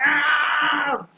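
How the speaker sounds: high mock yell of pain, a bit hammed-up; imitated pain cry. Lecturer imitating a snake-bitten hunter's cry of pain, mock yell. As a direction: act out the bitten boy's cry, high-pitched. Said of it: "He is shouting like".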